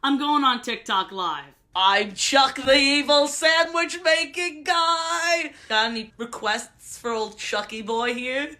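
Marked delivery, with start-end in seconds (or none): 1.7-8.5 slow, loud cartoon villain intro; Chuck villain voice. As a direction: announce yourself as a hammed-up villain. In character on TikTok Live.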